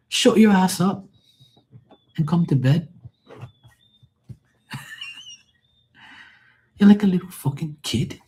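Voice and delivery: the Irish wife ordering him to bed; Irish woman snapping. Pretend. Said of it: "no more Irish, acting like some Irish jackass".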